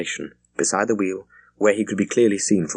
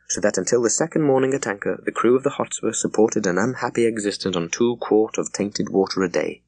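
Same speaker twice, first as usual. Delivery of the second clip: ordinary delivery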